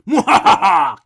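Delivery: loud evil laugh; mad scientist. Acted. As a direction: cackle like a gloating villain.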